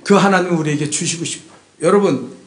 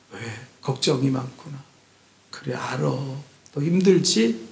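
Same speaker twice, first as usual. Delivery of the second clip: softer and lower, slowing to a gentle question; tender mother; 7 semitones lower, 9 dB quieter, slower than usual. Retold or imitated. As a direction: soothe a struggling daughter like a mother. Mother comforting daughter. (딸을 달래는 엄마, 부드럽고 느리게)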